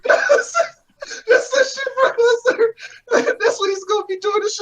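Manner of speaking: very high, squeaky wheeze through laughter; wheezing laughter. Real, not acted. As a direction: talk while out of breath laughing.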